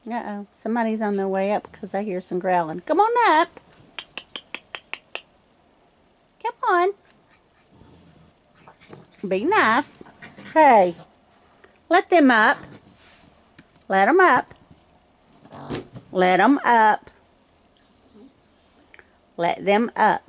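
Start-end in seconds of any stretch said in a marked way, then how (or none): 2.8-6.9 high, loud call up the stairs; loud high-pitched coaxing call. Real, not acted. Calling raccoons up the steps. 9.3-20.2 loud, firm telling-off to an animal; loud firm scold. Real, not acted. Scolding a raccoon blocking others.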